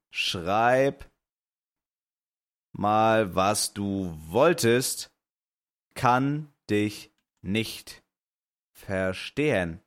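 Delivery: sehr langsam und deutlich, Wort für Wort; langsam ins Telefon. Real, not acted. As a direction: jedes Wort dehnen, damit der Betrunkene am Telefon es versteht. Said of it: "ich meinte grad so". (langsam und überdeutlich ins Handy gesprochen)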